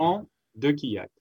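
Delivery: "Gontran Dekilak" said the French way, quick, with a nasal French "Gontran"; French pronunciation of his name. Shown as a demonstration. Guest shows the host how his name is said, French pronunciation. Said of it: "Tell me how to pronounce it".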